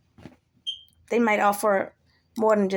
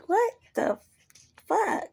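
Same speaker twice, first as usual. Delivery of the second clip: high, drawn-out disbelief; incredulous; 10 semitones higher, slower than usual. Acted. Speaker reacts to someone's dumb shit, high incredulous. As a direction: squeak it out, word by word.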